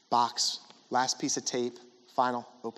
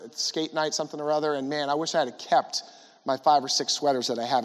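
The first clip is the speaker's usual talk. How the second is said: ordinary talk, nothing marked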